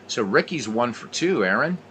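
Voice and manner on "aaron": normal talking voice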